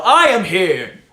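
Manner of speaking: loud triumphant shout; hero yell. Pretend. To his viewer, loud shout after a mock training montage. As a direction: bellow it like a hero arriving. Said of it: "And then I just yell".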